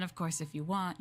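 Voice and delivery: usual speaking voice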